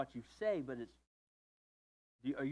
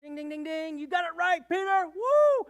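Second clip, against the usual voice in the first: high, loud game-show host cheer; Jesus playing quiz host for Peter; game show win; 13 semitones higher, 8 dB louder than usual. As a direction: ring the winner's bell and whoop. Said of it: "And Jesus is like".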